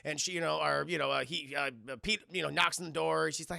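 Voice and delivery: ordinary talk, nothing marked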